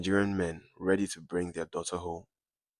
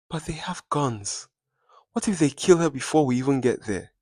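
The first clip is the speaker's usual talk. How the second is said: much higher, then louder, panicking; tearful fear; 7 semitones higher, 5 dB louder than usual. A narrator's reading. Mother fearing her daughter will be killed, trembling and high. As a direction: plead in terror through tears. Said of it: "Bisi was trembling, tears spilling".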